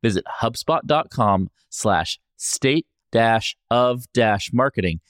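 smooth, measured sponsor read. Acted. Host reads a sponsor message, ad voice. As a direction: read the ad like a radio spot.

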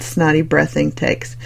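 ordinary delivery

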